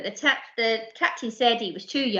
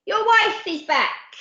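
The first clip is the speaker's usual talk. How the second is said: high, slow, loud warning yell; 9 semitones higher, 4 dB louder, slower than usual. Acted. Pirate yells to the captain while falling. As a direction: call it down from the crow's nest. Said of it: "yelled Percy".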